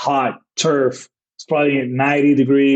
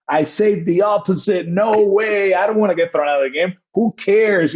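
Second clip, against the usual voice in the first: mock angry rant; 6 semitones higher than usual. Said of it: "acting like I'm actually mad at him".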